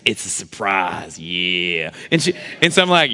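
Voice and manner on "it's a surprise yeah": low, slow seductive tease; sexy voice. Pretend. Husband teasing wife about surprise, slow sexy voice. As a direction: stretch it out lower, slow and suggestive. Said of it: "I use my sexy voice".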